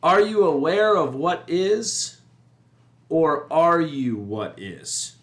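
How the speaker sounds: slow, weighty, drawn-out question; slow emphatic question. Shown as a demonstration. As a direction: pose it slowly as a big question for the listener. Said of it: "The second set of questions is".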